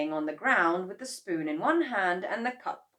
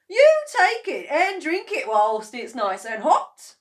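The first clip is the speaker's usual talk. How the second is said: sweetly; 10 semitones higher, 14 dB louder than usual. A narrator's reading. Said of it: "smiled sweetly".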